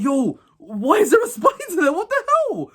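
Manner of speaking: high, panicky yelp rising to a squeal; freaked out. Retold or imitated. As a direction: squeal in alarm at the spider. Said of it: "I'm like".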